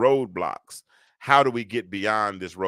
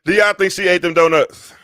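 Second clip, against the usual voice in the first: yelling across the room; 8 semitones higher, 10 dB louder than usual. Real, not acted. Loud question to the audience.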